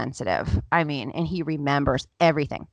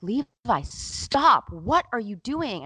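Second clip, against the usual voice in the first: higher-pitched, alarmed telling-off; retold exasperated scolding; 6 semitones higher, much slower than usual. Mother to son throwing the ottoman, exasperated scolding. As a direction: re-enact a fed-up parent's protest.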